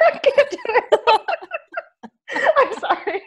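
high, laughing protest; helpless laughter. Real, not acted. Co-host cracking up as she tries to read her line, helplessly laughing. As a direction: give up through real laughter. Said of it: "I'm gonna laugh".

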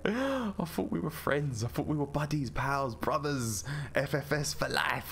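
high, drawn-out wounded plea; mock betrayal. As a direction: plead like a hammed-up betrayed friend.

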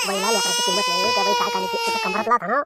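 a villager's high tearful outburst at the runaway; crying rant. Acted. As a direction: shriek out the grievance through tears. Elder rants while crying.

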